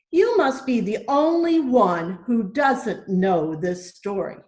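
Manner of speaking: ordinary delivery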